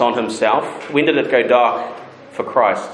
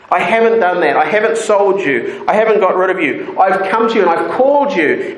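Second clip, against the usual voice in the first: pleading God; 6 semitones higher than usual. Acted. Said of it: "He's saying".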